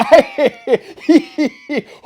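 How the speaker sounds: loud high guffaw; hearty laugh. Acted. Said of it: "said that gentleman".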